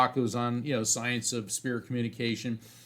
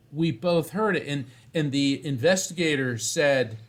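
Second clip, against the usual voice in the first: ordinary delivery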